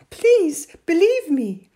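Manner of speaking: high, loud, slow plea; pleading. A narrator's reading. Boy begs his doubting mother, pleading. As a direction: beg earnestly to be believed.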